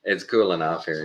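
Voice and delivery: ordinary talk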